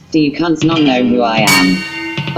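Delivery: ordinary delivery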